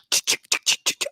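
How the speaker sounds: sharp, voiceless clicking noises; imitated gun cocking. Storyteller imitating guns being loaded before a hike, mimicked clicks. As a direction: mimic guns being readied with crisp "chick" sounds. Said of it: "And then I heard".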